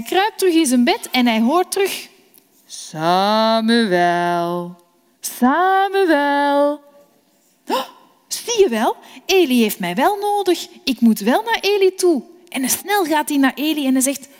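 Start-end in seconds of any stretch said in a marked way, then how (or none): 2.7-6.8 voice of God calling. Acted. God calls Samuel at night. 7.7-12.2 lightly voiced boy, higher and excited; a child sure he is needed; young Samuel eager to go to Eli.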